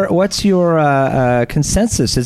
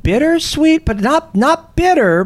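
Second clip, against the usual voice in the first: excited; 12 semitones higher than usual. Real, not acted. Excited tasting description.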